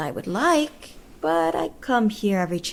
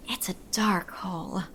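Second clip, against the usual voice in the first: suggestive whisper; 5 dB quieter than usual. Acted. Suggestive aside.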